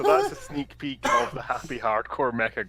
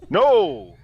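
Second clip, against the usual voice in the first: dramatic shout; 6 semitones higher, 9 dB louder than usual. Retold or imitated.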